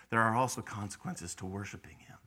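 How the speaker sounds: hushed, breathless whisper; whispered aside. Real, not acted. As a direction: drop to a whisper to draw them in. Sudden hush in sermon.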